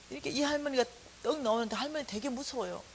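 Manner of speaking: ordinary talk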